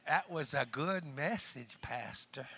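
soft, drawn-out Southern church lady; Southern accent. Retold or imitated. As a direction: imitate a sweet Southern lady, slowly. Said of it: "with that Southern accent".

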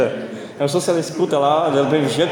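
ordinary talk, nothing marked

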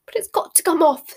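high, hushed squeak; squeaky. A narrator's reading. Hermione squeaking to Ginny about her face. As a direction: squeak it out in a panic. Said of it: "squeaked Hermione".

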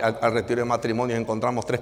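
ordinary talk, nothing marked

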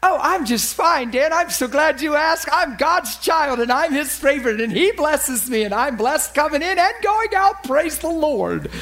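high, over-bright churchy testimony voice; Trish. Acted. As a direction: gush piously, stretching to a big finish. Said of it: "And she says".

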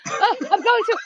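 high, laughing start of an answer; mock flustered caller, laughing. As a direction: squeak it out through a laugh.

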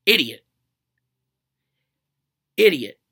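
a loud, scornful single word; disgusted. Real, not acted. To the audience about the friend, a loud scornful jab. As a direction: spit it out with contempt.

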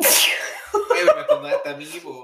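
high laughing complaint. Real, not acted. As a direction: laugh while protesting being sneezed on. Laughing complaint after being sneezed on.

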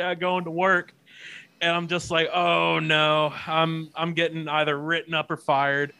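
ordinary talk

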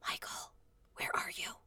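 hushed whisper into the dark room. Retold or imitated. Mother whispering her son's name in dark nursery. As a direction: whisper his name so as not to wake him. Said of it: "and I'm like".

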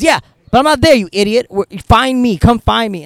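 high, loud impatient mock yell; irate passenger. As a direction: imitate an exasperated rider shouting orders. Retelling irate passenger demanding pickup.

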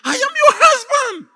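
very high, loud, crying shout; desperate crying plea. Acted. Husband locked out by his wife, desperate crying plea. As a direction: plead through sobs to be let in. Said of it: "the guy said".

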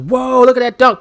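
high, loud mock amazement; amazed reaction. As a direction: exclaim like an awed fan watching a dunk. Acts out old amazed reaction.

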